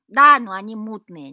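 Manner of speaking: loud, discontented retort; disgruntled grumble. A narrator's reading. Cat complaining to Ivan about his family, disgruntled. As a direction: grumble it out loudly, displeased. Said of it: "недовольно буркнул кот".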